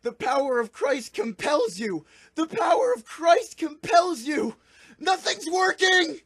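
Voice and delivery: high, frantic full-voiced yelling; panicked shouting. Two exorcists see the chant failing, panicked shouting. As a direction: shriek it in mounting desperation. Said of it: "Both Messmit and Kevin are panicking".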